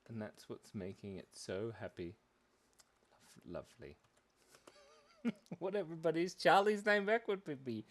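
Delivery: ordinary talk, nothing marked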